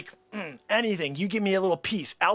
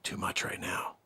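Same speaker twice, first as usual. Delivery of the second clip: secretive whispered sales pitch; hushed whisper; 14 dB quieter than usual. Acted. Salesman whispering a secret tip to a client on the phone, hushed whisper. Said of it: "I don't want anybody here on the floor to know".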